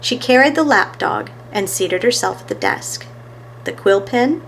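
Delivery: ordinary delivery, nothing marked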